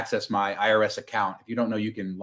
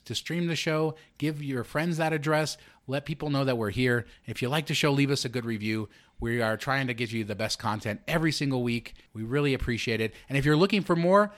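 upbeat host promo read; podcast ad read; 2 semitones higher, 6 dB quieter than usual. Acted.

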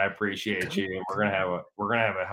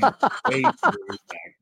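high, creepy stage whisper; mock-menacing whisper; 5 dB louder than usual. Friend promising payback to host, playful menacing whisper. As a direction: hiss it as a playful threat. Playful threat repeated in odd voice.

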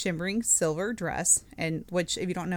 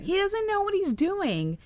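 pitched way up in exasperation; exasperated high echo; 13 semitones higher than usual. Real, not acted. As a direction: squeal it in disbelief.

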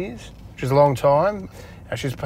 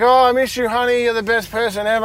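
mock pleading wife, pitched higher and louder; mocking wife begging; 10 semitones higher, 5 dB louder than usual. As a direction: ham up a clingy partner begging him back. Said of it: "She'd come crying back".

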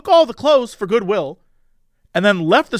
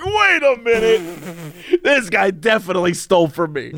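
sudden realization. Retold or imitated. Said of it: "And I was like".

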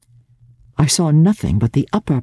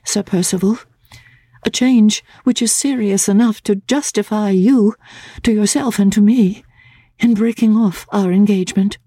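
put-on higher, gentle young woman's voice; Laura's voice; 9 semitones higher than usual. A narrator's reading. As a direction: voice Laura, trembling with resolve.

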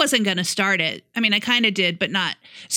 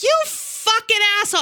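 high mock yell at a driver; retold yell; 12 semitones higher than usual. Driver yelling at a pickup truck through a closed window, high mock yell. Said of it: "I'm, like, yelling".

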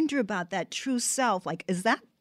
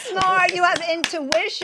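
gushing, higher and louder; excited praise; 7 semitones higher, 6 dB louder than usual. Real, not acted. Cohost praising guest's clever dress choice, excited. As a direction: rave about her cleverness.

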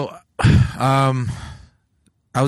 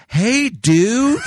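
loud, high, drawn-out greeting; exaggerated slow talking; 11 semitones higher, 6 dB louder than usual. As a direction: imitate talking down to someone slow. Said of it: "trying to talk to me slower".

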